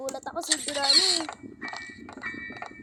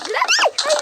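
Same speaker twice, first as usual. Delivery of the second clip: much higher, louder sudden shriek; startled scream; 13 semitones higher, 10 dB louder than usual. Real, not acted. Gamer suddenly startled by Granny, shrieking. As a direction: jump and shriek the name.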